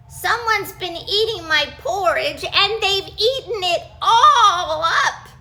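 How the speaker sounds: high, loud, wailing little bear; tiny baby bear voice. Acted. Baby Bear crying over his eaten porridge. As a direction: cry it like a tiny upset cub. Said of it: "he cried in his teeny tiny voice".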